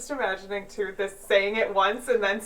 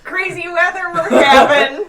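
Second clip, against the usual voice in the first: laughing small talk; mock chit-chat, laughing; 6 semitones higher, 9 dB louder than usual.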